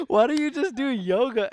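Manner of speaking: mom voice, laughing. Retold or imitated. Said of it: "she's like".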